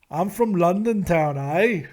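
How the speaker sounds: hammed-up London accent; bad London accent. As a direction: fake a London accent badly. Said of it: "I could do a better accent than that".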